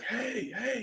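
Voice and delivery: high-pitched calling out at reduced volume; mock yell. Man calling to the searchers, a restrained mock yell. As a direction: imitate a distant yell, held back. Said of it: "he's yelling to the people".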